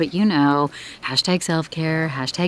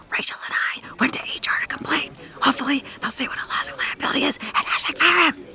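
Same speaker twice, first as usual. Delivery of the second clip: hushed, fast conspiratorial whisper; whispered office gossip, quick; 6 semitones higher, 5 dB quieter, faster than usual. Acted. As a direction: lean in and whisper the scandal. Secret complaint to HR, whispered.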